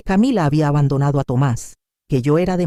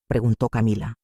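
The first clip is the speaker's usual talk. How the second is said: usual speaking voice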